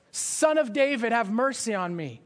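high, loud begging shout; relentless cry. Retold or imitated. Beggar shouts again over the crowd, relentless loud plea.

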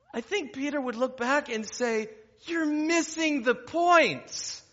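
loud, high, driving sermon delivery; full-force preaching. Real, not acted. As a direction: preach it out to the whole room.

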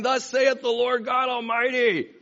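high, ringing mock proclamation; mock-solemn holy announcement; modulated prophet voice. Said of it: "modulating our voice and saying".

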